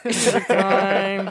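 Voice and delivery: drawn-out hyped announcement; slow, loud, grand intro call; jingle announcer. Acted.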